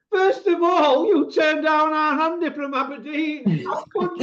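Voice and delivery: high, slow hammed-up indignation, ending in a whisper; mock outrage. Scolding Andy as a mock fan, hammed-up outrage. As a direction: play wounded disbelief over the top.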